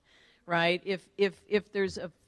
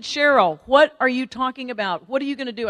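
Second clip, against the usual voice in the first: loud, higher-pitched demanding questions; exasperated mother; 5 semitones higher, 9 dB louder than usual. Retold or imitated. Mother questioning daughter's business plan, exasperated. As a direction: confront her like a doubting mom.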